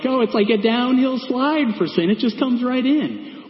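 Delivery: high-pitched, sing-out mock reaction; breezy mock glee. Voicing an easygoing sinner's reaction.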